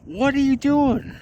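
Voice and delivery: loud playful outburst, very high; mock scolding. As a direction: squeal it in pretend disbelief. Mock scolding of fellow CW operators.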